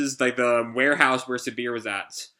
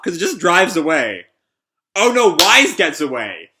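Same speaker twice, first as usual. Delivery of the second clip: usual speaking voice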